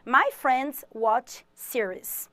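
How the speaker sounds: My friends watch series said very slowly; slow English model. Shown as a demonstration. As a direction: spell it out word by word for the class. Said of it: "é, assistem séries".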